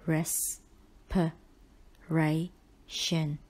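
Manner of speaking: "Res-per-ration" very slowly as res, per, ray, shun; broken into syllables. Shown as a demonstration. Teacher helping students remember the spelling, syllable by syllable. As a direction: separate each syllable of "Res-per-ration" with clear pauses. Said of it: "Break the word up".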